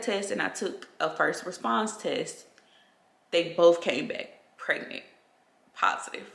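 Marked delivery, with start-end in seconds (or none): none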